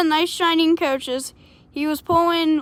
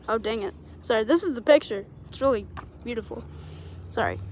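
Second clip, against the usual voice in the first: quiet aside; 4 semitones lower, 9 dB quieter, faster than usual. Real, not acted. Reader aside, shows picture.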